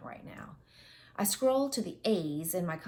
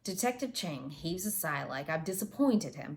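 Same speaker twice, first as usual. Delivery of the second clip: plain reading voice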